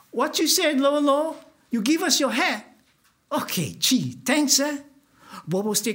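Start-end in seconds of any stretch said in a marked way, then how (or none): 0.1-4.8 1 mocking teasers. Mooks mock Bobo.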